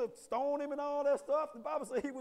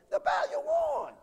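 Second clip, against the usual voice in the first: very high, loud shout; triumphant shout; 14 semitones higher, 5 dB louder than usual. Real, not acted. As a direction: yell it to the rafters. Preacher shouting the punchline.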